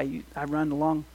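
normal talking voice

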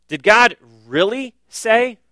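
slow mocking serpent voice asking about God; voicing the serpent. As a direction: plant doubt like the serpent. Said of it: "serpent's whispered doubt voiced".

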